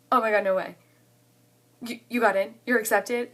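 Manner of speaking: loud, excited disbelief; imitating an excited friend; retold excitement. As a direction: react loudly, as a friend hearing big news. Friend reacts to acceptance news.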